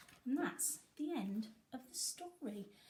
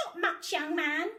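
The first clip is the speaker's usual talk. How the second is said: put-on higher, louder old granny voice; a cheeky old lady brushing him off; criminal gran; 6 semitones higher, 7 dB louder than usual. A narrator's reading. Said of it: "said the criminal gran".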